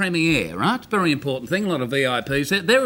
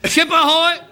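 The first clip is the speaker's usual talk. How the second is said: lookout's call; 7 semitones higher, 4 dB louder than usual. Acted. Lookout calling out again.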